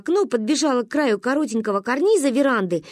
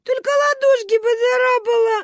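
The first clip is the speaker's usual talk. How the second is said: high-pitched tearful whine; sobbing child; 14 semitones higher, 4 dB louder than usual. A narrator's reading. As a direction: wail it like a crying little girl. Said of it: "рыдала Маня". (рыдая, тонким голосом)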